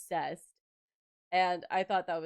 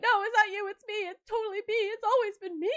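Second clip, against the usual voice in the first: high, loud, flustered babble; hammed-up frantic insistence; frantic excuse; 8 dB louder, faster than usual. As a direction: blurt it out in retold panic. Acts out frantic excuse to date.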